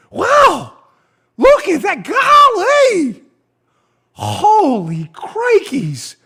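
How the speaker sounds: a gawking fool who can't believe his luck; mock amazed agent. Mocking amazed FBI agents.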